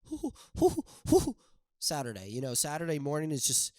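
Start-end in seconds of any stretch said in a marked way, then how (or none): 0.0-1.4 high excited hoo hoo whoops; high excited hooting. Acted. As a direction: whoop like you're pumped up. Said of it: "you're just like".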